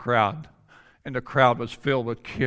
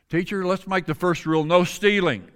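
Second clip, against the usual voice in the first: a student calls out higher and louder, eager to offer a rule; eager student calling out; 7 semitones higher, 3 dB louder than usual. Retold or imitated. A student calls out the first rule to the teacher, eager and high. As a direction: pitch up and call it out to the teacher.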